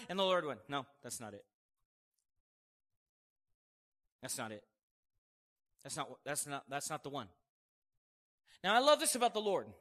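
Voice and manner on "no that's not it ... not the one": low, soft acted rejection; understated, hushed dismissal; quiet refusal. Acting the Lord rejecting the offering.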